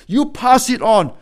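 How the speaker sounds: ordinary talk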